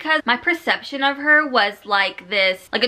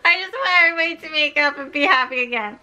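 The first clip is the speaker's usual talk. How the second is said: fast, tearful laughing whine; emotional laugh-cry plea; hurting; 3 semitones higher, much faster than usual. Real, not acted. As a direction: plead it quickly, half crying, half laughing. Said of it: "really hurting me".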